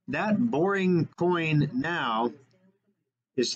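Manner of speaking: drawn out, very slow; slow and weighty. Real, not acted. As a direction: stretch every word for effect. Dramatic reveal of price.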